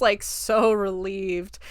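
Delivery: ordinary delivery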